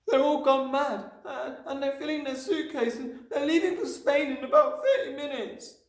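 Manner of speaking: put-on very high, loud child's voice; frantic little girl pouring out news; distressed cry. A narrator's reading. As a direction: blurt it out, pitched right up. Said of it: "she cried".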